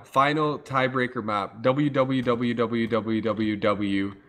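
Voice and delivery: normal talking voice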